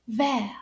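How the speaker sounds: vert, silent T, said like vair; French vert. Shown as a demonstration. Teacher models vert for children, T silent. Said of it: "and we say it like this".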